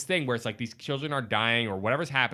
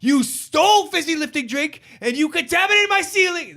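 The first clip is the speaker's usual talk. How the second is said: high, loud, slow seething rant; furious Wonka scolding Charlie; Wonka raging; 5 dB louder than usual. Retold or imitated. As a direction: spit each word out in fury. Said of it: "he goes".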